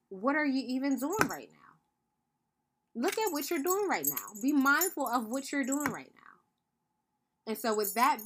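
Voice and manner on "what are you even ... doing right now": put-on higher and pointed, like a parent making a child notice herself; pointed inner voice. Mother voices the energy beneath her fake crying, pointed and higher. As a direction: press each line home to make her stop and look. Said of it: "My underlying energy is".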